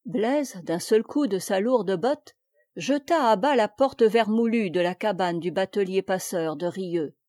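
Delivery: plain reading voice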